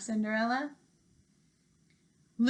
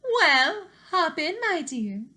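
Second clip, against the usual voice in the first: cheery fairy godmother; 7 semitones higher, 5 dB louder, slower than usual. Acted. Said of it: "said the fairy godmother".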